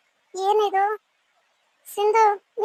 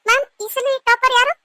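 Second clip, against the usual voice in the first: calling out; 5 semitones higher, 7 dB louder than usual. Acted. Student calls out question to teacher.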